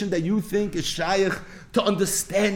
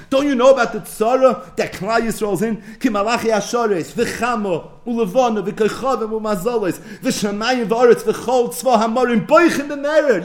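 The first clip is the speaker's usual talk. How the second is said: higher and louder, building urgency; impassioned plea; 5 semitones higher, 4 dB louder than usual. Retold or imitated. Elijah urges the sleeping patriarchs, rising and louder. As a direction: pile it on, each word more desperate. Elijah lists the weeping heavens.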